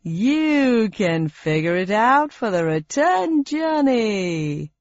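slow, smug quiz host; unpleasant smile. Acted. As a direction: say it with a nasty grin. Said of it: "smiled in an oddly unpleasant way".

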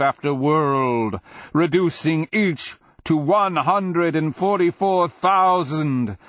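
loud, high declaiming, building to a slow cry; beast's tirade. A narrator's reading. Dragon recalling its makers' damnation, loud building tirade.

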